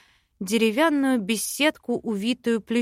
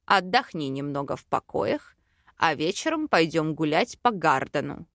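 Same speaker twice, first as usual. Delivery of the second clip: low-pitched male character voice; fiancé character, calm; 6 semitones lower than usual. A narrator's reading. Fiancé suggests rest and an evening walk, calm low voice.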